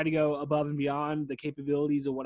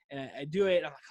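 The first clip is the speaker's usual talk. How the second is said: usual speaking voice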